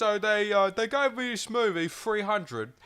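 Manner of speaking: high-pitched, hammed-up Cockney; mock a posh actor's off-duty Cockney; Lena Headey. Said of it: "that's what they sound like".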